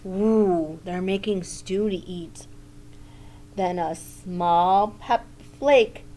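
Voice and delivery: plain reading voice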